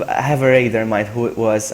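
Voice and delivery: usual speaking voice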